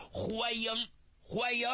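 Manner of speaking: a hint of a pleased official; hearty official. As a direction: welcome him gladly. Official welcomes son.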